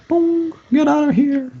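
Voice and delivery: very high-pitched mental message; high, acted mind-voice; telepathic ping. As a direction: pipe it out high, like a ping in the head. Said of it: "you all get this mental ping".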